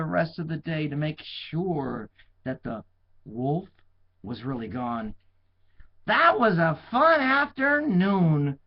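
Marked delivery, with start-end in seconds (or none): 6.1-8.6 high, slow, satisfied; pleased shepherd boy. A narrator's reading. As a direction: savour the fun. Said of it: "thought the shepherd boy".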